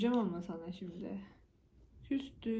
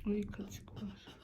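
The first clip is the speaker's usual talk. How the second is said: whispering; 8 dB quieter, much slower than usual. Real, not acted. Whispered aside while feeding.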